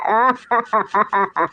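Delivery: long cackling laugh; mocking laughter. Prankster laughing after mimicking Sambo's cry for help, mocking cackle. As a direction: burst out laughing at your own mimicry.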